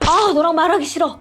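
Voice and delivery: hammed-up annoyed husband; irritated. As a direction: shut the talk down sharply. Husband ends the quarrel.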